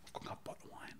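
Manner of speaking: barely audible whisper, acted out; hushed request. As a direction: whisper so the sleeping cabin stays asleep. Sneaking drinks while cabin sleeps.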